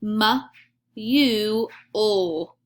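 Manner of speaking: M, U, O said as separate sounds; sounding out words. Shown as a demonstration. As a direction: sound out M, U, O slowly, one by one. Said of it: "I'll go first".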